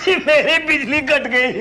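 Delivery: high, drawn-out words through laughter; laughing. Acted. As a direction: announce bad news while laughing helplessly. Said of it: "आप हंस रहे हैं". (हँसते हुए, ऊँची आवाज़ में)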